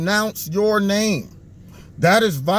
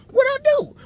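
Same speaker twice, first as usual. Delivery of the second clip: much higher squeaky protest; mock bewildered. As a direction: squeak it out in disbelief. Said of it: "I'm in jail".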